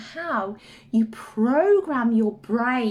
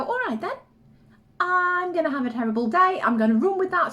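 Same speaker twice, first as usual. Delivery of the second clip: eager brain voice; 6 semitones higher, faster than usual. Retold or imitated. Mother voicing the brain answering the child, chirpy. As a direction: play the helpful brain saying "sure thing".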